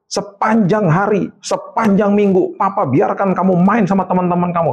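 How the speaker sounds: high, drawn-out retold scolding; imitated angry father. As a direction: act out dad laying into his child. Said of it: "Wah, dia marah".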